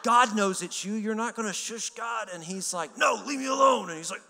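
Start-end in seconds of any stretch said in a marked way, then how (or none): 0.0-2.3 high, loud mock-indignant comeback; loud retort. Retorting loudly after being shushed. 3.0-3.9 high, loud shout to back off; high-pitched, resisting yell; acted protest.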